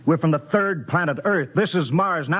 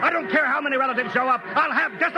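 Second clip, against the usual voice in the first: stern anger; 8 semitones higher than usual. Acted. Captain demands discipline.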